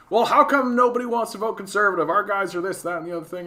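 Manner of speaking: imitating a conservative's honest question; mock conservative voice. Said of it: "the question is honest".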